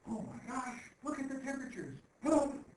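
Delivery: high, hammed-up panic; mock alarm. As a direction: gasp it out like a scaremonger. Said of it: "and they're like".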